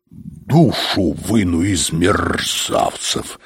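slow, low menace; menacing threat. A narrator's reading. Inspector vows over his papers to punish culprits, menacing.

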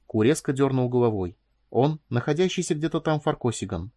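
ordinary delivery, nothing marked

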